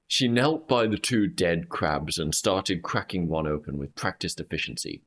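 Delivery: normal talking voice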